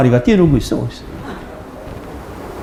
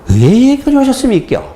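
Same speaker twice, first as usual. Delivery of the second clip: 경상도 억양으로 높게 묻기; Gyeongsang accent; 11 semitones higher than usual. Retold or imitated. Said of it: "경상도 어투가 근데 처음 접했기".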